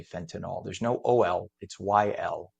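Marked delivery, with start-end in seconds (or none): 0.0-0.6 fentanyl ending in "nall"; FEN-tuh-nawl for fentanyl; mispronunciation. Retold or imitated. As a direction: say fentanyl with a final syllable like "all". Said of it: "Drives me nuts when people say".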